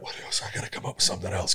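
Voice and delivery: fast, whispered inner voice; whispered thought. Retold or imitated. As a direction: rush it under your breath. Inner thought in his head.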